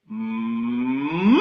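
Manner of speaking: lips pursed, hum a siren rising up. Shown as a demonstration. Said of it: "I'll have another go".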